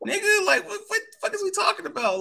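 high, worked-up jab; worked up, jabbing at a player in a high voice; heated high rant. Real, not acted. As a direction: climb high in disbelief. Ranting about a rival player, pitch way up.

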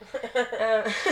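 lightly mock her excited surprise; imitating Laura. [name] imitating Laura thrilled at new followers, mock gushing. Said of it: "so that she can get on and be like".

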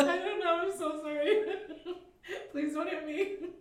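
giggling through a scared apology; nervous giggling apology. Retold or imitated. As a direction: apologise while laughing helplessly. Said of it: "my natural reaction when I get yelled at is to giggle".

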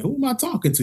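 high-pitched questioning reply; incredulous high question; retold puzzlement.

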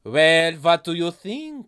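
put-on loud French-accented retort. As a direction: answer in a thick French accent. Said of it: "focused on this accent".

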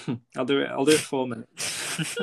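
normal talking voice

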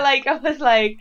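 normal talking voice

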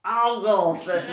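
very slow mock Liverpool voice, laughing; Scouse accent. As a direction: play Paul in a hammed-up Scouse accent. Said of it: "get rid of the accent".

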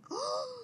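sharp audience gasp, acted out; imitated shocked gasp. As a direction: gasp like a stunned theater crowd. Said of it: "everyone just kinda stopped and was like".

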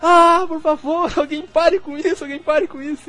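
imitating a woman in labor, begging it to stop; screaming woman in labor. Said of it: "a mulher começa a gritar assim no vídeo".

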